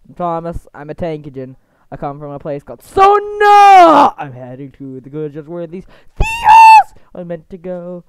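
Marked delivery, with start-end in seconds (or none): 2.8-4.1 high, very loud sudden scream; mock-dramatic high yell; screamed place name. Blurts place name in parody. 6.2-6.9 high, loud outburst mid-sentence; sudden comic scream. Pretend.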